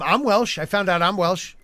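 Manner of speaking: ordinary delivery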